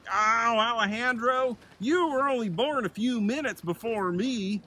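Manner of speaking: high, eager younger bear voice; a cheerful little-brother bear calling out, pitched high; Buzz's voice. Acted. Buzz greets his older brother Alejandro, high and eager.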